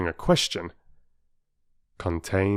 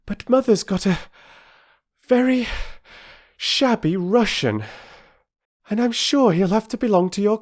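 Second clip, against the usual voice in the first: breathless; 11 semitones higher, 4 dB louder than usual. A narrator's reading. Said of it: "she said breathlessly".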